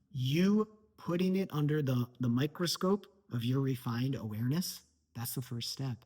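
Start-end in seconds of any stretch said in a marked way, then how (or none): none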